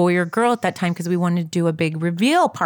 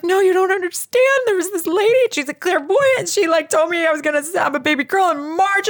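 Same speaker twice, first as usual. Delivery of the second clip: much higher, tearful rush of words; emotional outburst; 10 semitones higher than usual. Retold or imitated. To the nurse, explaining the clairvoyant, high and emotional. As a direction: pour it out, overwhelmed. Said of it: "And I'm like".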